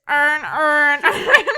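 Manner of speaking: loud, amused Baltimore accent imitation. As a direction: mimic a thick Baltimore accent, laughing. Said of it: "if you have a Baltimore accent".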